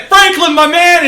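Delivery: loud high friendly yell; hearty mock greeting. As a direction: call out across the yard. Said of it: "it's like".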